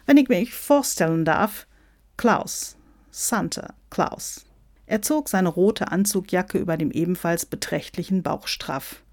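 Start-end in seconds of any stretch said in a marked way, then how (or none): 0.0-4.4 grand self-introduction with dramatic pauses; self-important American Santa. Acted. Santa presents himself to the call, self-important. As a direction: introduce yourself with suave showmanship.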